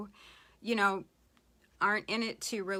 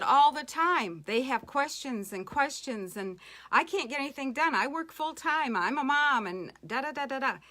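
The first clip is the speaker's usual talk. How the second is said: mock overwhelmed venting, higher and louder; retold exasperation; 5 semitones higher, 5 dB louder than usual. Group leader venting on the phone about endless questions, mock exasperated. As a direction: imitate a frazzled complaint, pitch lifted.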